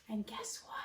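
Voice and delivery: secretive whisper. Acted. Teacher to her class, suspenseful whisper. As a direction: lean in and tell a secret.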